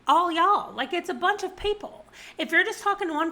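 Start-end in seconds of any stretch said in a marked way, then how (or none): 0.1-0.7 all y'all in a light Southern drawl, louder. Shown as a demonstration. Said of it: "It's like".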